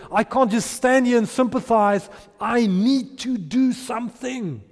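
"I need to do something" slowed right down, each word weighted; emphatic resolve. Acted. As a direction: declare it, leaning hard on "need to do something". Acting out the urgent compassion word.

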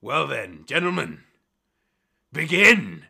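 building to a loud command; grinning captain. Acted. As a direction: start measured, then call out the start. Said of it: "he gives a big toothy grin".

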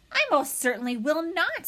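a touch of indignant goose squawk. A narrator's reading. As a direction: refuse like an affronted goose. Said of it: "squawked the goose".

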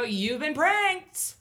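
high, slow, drawn-out announcement; prank reveal. Retold or imitated. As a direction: stretch it out loud like a game-show reveal.

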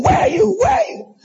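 hammed-up panicked calling; frantic phone shout. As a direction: yell down the line for the missing friend. Mock phone call to absent student.

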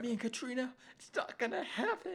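very high, loud mock wail; imitating a heartbroken panic; freaking out. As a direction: climb into hammed-up hysterical despair. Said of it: "freaking out about Darren".

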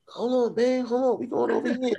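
high-pitched retold call to wait; mock urgent. Husband stopping his running wife at airport, mock urgent. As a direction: playfully act out calling her back.

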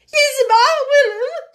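high, loud, slow quavering voice; trembling reply. A narrator's reading. Frightened maid answering her mistress, trembling. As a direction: tremble out the promise.

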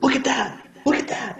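high, urgent exclamation; imitated excited friend. Imitating a friend in the crowd, high and urgent. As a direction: point it out in high-pitched alarm. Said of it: "I remember one of my friends going".

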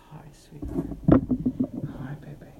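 breathy whisper, no voice. Real, not acted. Whispering to the cat.

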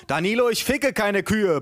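high indignant holler; Maurice bellowing. Acted. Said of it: "brüllte Maurice".

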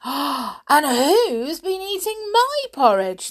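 high, loud, slow indignant mama bear; mother bear. A narrator's reading. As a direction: raise the pitch and draw out the complaint. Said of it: "said the mother bear".

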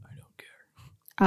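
slow breathy whisper; whispered quote. Retold or imitated. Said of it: "and now I'm like".

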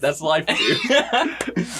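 drawn-out line through laughter; joking dad reply. Acted. Dad shrugging off the murder, laughing mock delivery.

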